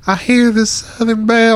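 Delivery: high, loud Southern belle imitation. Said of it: "she started talking like a Southern belle".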